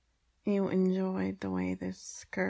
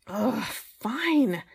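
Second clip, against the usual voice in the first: grudging; 3 semitones higher, 8 dB louder than usual. Acted. Grudging agreement.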